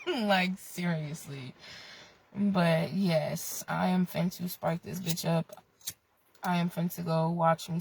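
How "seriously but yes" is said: ordinary talk, nothing marked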